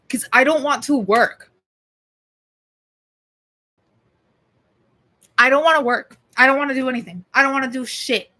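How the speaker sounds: loud petulant complaint; whiny outburst. Real, not acted. Ranting about not wanting work.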